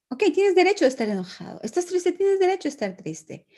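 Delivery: put-on high, bright, validating voice to a child; reassuring parent. Shown as a demonstration. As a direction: tell him kindly he has the right to feel it. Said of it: "es como". (madre tranquilizando a su hijo, voz alta y amable)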